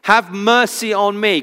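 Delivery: high mock cry for attention; retold crying out. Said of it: "he screams out for his attention".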